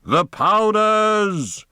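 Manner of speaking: very high, slow, loud shout; shouted command. Acted. Poe atop a boulder shouts the command to his army. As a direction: bellow the order from the boulder. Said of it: "he shouted".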